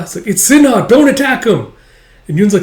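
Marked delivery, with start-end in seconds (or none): 0.3-1.7 high, loud protest; urgent shouted plea. Acted. Boy begs soldiers to spare friend, shouted. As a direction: plead at full voice.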